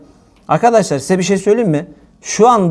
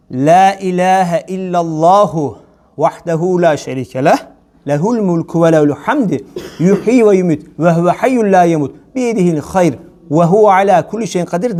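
dhikr recitation; 2 semitones lower than usual. Acted. Recites Arabic dhikr formula.

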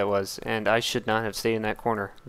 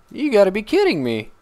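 ordinary talk, nothing marked

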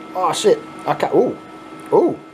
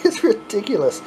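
laughing; 6 semitones higher than usual. Real, not acted. Laughing at own shaking over prank.

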